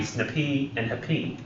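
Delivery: snippy and happy with the weight on the final -py; stress on second syllable. Shown as a demonstration. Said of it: "repronounced it with the P being".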